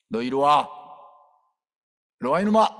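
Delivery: put-on loud, sharp call to a naughty child; stern scolding. Preacher calling a spoiled boy over, loud and stern. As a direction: summon the brat firmly.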